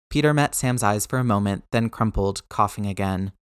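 ordinary delivery, nothing marked

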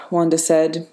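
ordinary delivery

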